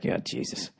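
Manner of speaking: hushed, under the breath; retold cowed mutter. Husband reacting to his screaming wife, cowed whisper.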